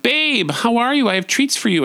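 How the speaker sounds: put-on high, gushing hello to a child; doting greeting. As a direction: greet your little one in a high, sweet voice.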